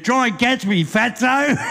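high mocking challenge to the sergeant; cheeky dare. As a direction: tease him into chasing you. Drunk daring the cop.

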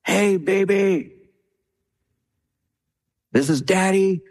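high, slowed call into the belly; mock yell to baby. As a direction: call out to the unborn baby, playful. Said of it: "and I would yell".

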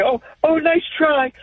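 high, sing-song mock encouragement; imitating overly nice fans; mocking polite fans. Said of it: "make fun of the Saint Louis Cardinals fans".

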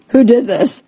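usual speaking voice

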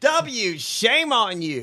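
slow, drawn-out shame; theatrical slow reproach; mock scolding. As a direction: wag a finger slowly. Scolding Bush.

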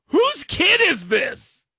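high, slow call across the pool; calling out to parents. Retold or imitated. Lifeguard calling out to parents at the pool, loud. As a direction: hold the kid up and call out for the parent.

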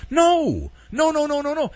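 high, insistent string of nos; exasperated refusals. Retold or imitated. Host voicing the school refusing Kelly's sandwich, high and insistent. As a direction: shut him down, no after no. Acted flat refusal of the offer.

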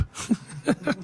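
a sudden delighted laugh; high breathy laugh. Real, not acted. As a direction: laugh out at the joke. Host laughs at the line.

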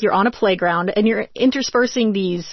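ordinary talk, nothing marked

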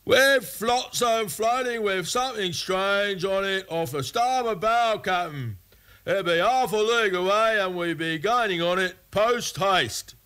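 high-pitched pirate lookout calling the captain; lookout's cry. A narrator's reading. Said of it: "a cry came from the topmast".

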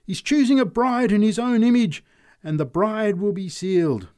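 high, loud triumphant retort. A narrator's reading. Preacher answering the drunk, triumphant. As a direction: crow it back at the heckler. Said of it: "she answered triumphantly".

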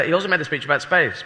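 ordinary delivery

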